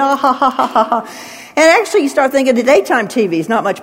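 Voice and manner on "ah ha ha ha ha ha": hammed-up fake ha ha ha; mock laughter. Preacher imitating TV audiences laughing at sin, fake laughter. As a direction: fake a silly sitcom laugh.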